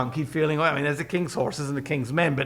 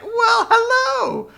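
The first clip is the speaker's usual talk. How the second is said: high, drawn-out mock welcome; sing-song hello, pitched way up; hammed-up greeting; 4 dB louder, slower than usual. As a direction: greet them with slow, exaggerated delight. Balaam greets returning messengers.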